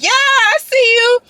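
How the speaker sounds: high, loud, slow hype call; mock coach hype; hyped encouragement. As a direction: cheer them on. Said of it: "he was like".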